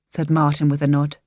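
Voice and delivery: ordinary delivery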